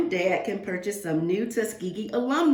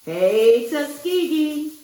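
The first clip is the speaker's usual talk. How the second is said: much louder hey, then a slow higher call; school cheer; 6 semitones higher, 5 dB louder, slower than usual. Pretend. Alumni calling to friends on the Ave, loud cheer. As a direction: belt out the greeting like at homecoming.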